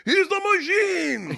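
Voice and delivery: put-on very high, drawn-out accented proclamation; Russian cheer. Gangsters introducing student, high triumphant accented cry. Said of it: "And they're like".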